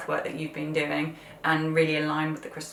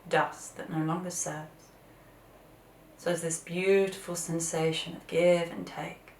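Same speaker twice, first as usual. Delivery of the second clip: guided meditation. Acted.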